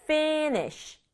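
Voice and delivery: slow, two-beat finish. Shown as a demonstration. Teacher modelling finish for students, slow in two beats. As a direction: stretch finish over two beats, ending in airy sh. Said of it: "두 박자로".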